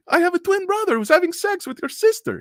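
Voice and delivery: very high, hammed-up Spanish accent; telenovela cheater. Cheater blaming his twin brother, high melodrama. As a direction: protest in a high soap-opera voice. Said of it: "telenovelas on Spanish, uh, T V".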